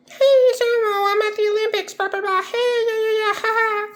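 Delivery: squeaky, very high Elmo imitation; very high excited Muppet voice. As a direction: chirp it out like the furry red puppet. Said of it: "That, that's Elmo".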